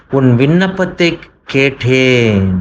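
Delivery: slow, weighty first statement; measured promise of God; slow emphatic. Acted. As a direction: lay the words down deliberately. Preacher voicing God's reply.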